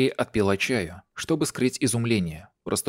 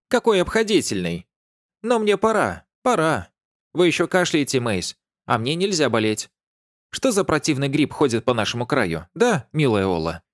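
rattling on; 7 semitones higher, 3 dB louder than usual. A narrator's reading. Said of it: "тараторила она".

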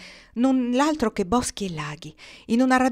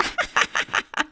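long, wicked acted cackle; crow's cackle. A narrator's reading. Crow laughs at own cruel story, cackling. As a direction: burst into gleeful cawing laughter.

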